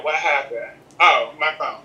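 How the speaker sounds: ordinary talk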